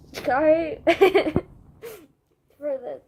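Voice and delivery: slow, drawn-out words, laughing through tears; apologizing while still teary; crying laugh. Real, not acted. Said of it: "random out of fucking nowhere waterworks".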